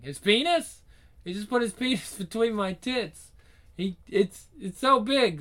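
high, loud flustered disbelief; flustered shock. Acted. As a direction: react breathless and flustered. Flustered reaction, laugh heard.

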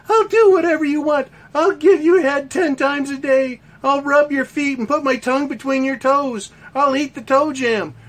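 mock a desperate woman pleading; woman begging. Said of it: "a woman fucking begging".

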